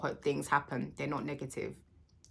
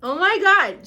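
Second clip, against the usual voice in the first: high, loud burst of emotion; real overcome outcry; overwhelmed exclamation; 13 semitones higher, 12 dB louder than usual. Real, not acted. Birthday woman alone with her cake, overwhelmed. Overcome before singing to herself.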